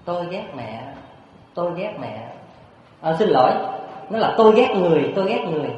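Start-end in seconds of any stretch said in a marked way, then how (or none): 0.1-2.3 soft, distant forest echo; hushed imitation of an echo; echoing back. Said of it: "trong rừng sâu vang lại những tiếng". 4.4-5.7 raised, high mock yell; a boy's cry, retold with force. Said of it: "Nói như vậy đó".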